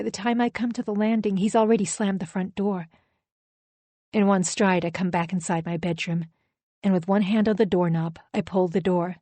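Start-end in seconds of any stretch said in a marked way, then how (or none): none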